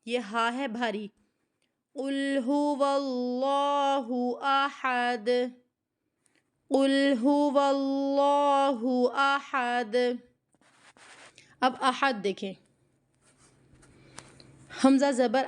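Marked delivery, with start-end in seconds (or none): 1.9-9.9 very slow chanted قل هو اللہ with drawn vowels; Quran recitation. Shown as a demonstration. Teacher recites slowly so students read along. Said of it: "اس کی ادائیگی میں".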